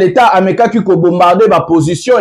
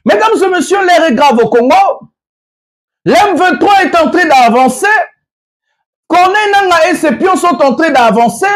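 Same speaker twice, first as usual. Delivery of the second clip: urgent raised voice; 7 semitones higher than usual. Real, not acted. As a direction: sound the alarm to the viewers. Alarm raised to the audience.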